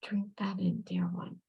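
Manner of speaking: whispered comfort to the tearful sitter; soft whisper. Real, not acted. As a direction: breathe the words out gently. Medium soothing a crying sitter.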